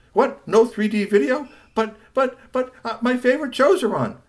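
put-on high, loud disbelieving kid; shocked protest. Son protests losing 3D video, shocked. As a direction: sputter in high, loud dismay.

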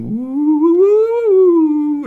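loud high wavering machine whoop; one long high woo-woo; whirring siren-like woo; recorder whooping. Acted. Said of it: "the recording was recording".

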